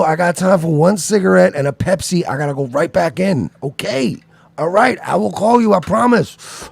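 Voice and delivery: put-on high, loud placating boyfriend; guy on phone. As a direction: sweet-talk someone on the phone. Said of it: "they're like".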